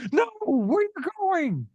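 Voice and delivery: high, squeaky plea; retelling the caterpillar calling after the hero; desperate plea. Retold or imitated. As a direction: cry out after someone who is leaving. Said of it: "And he's like".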